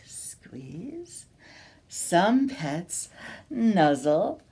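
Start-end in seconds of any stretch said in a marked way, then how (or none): 0.1-1.3 soft breathy squeeze; hushed squeeze; whispered squeeze. Pretend. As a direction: whisper it as you hug tight. Acting out a squeeze.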